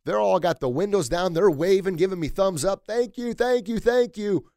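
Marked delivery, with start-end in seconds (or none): 2.9-4.5 a higher, louder burst of thanks; gushing thanks from a passing car; grateful family. Retold or imitated. Family in the next car thanking him, mildly gushing.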